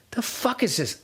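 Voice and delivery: hoarse, throat-clearing voice; frog in throat. Real, not acted. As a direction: talk through a frog in the throat. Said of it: "frog in my throat".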